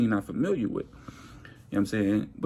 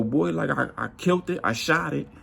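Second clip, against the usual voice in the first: normal talking voice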